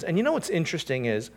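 usual speaking voice

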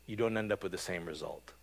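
usual speaking voice

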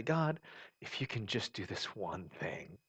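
soft, slow plea to God; hushed imitated bargaining prayer; quiet pleading prayer. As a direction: beg softly.

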